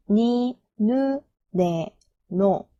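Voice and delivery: very slow, evenly spaced kana drill; chant-like slow row recital; syllable-by-syllable recitation. Shown as a demonstration. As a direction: model each syllable for learners, held and separated. Teacher models the na row.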